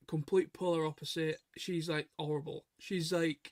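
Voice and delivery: ordinary talk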